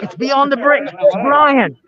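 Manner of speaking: spotting a famous face, thrilled; excited surprise. Real, not acted. Spots a celebrity in crowd.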